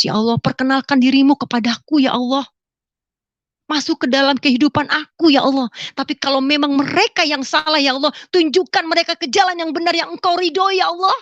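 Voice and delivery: high, fervent pleading prayer; berdoa memohon. Retold or imitated. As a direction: plead earnestly with God, pitch climbing. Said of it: "Saya berdoa demikian". (doa memohon dengan sungguh-sungguh)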